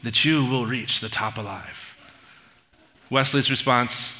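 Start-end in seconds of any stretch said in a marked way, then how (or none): 0.0-1.9 mock-dramatic oath, hushed and lower; Inigo Montoya. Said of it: "his voice goes all dramatic".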